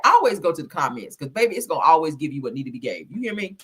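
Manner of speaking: ordinary delivery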